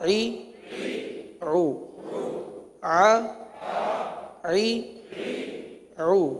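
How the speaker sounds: slow, measured recitation for pupils to repeat; drawn-out spelling drill. Shown as a demonstration. Teacher leading pupils through Arabic letters, slow chanted drill. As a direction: chant each letter name long and level, then the short syllable.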